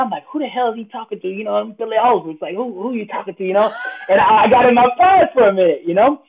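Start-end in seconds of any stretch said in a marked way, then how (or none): 0.3-1.3 higher, softer inner fuming; mad and hurt inside. Retold or imitated. Hurt speaker silently answering trainer, indignant. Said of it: "I was mad and hurt on the inside". 2.5-3.4 higher, softer mock indignation; mad and hurt inside. Hurt speaker silently answering trainer, indignant. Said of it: "I was mad and hurt on the inside".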